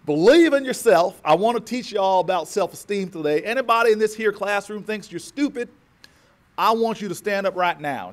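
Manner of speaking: loud Southern schoolteacher addressing her class; Southern accent. Retold or imitated. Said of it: "it's the best Southern accent I can do".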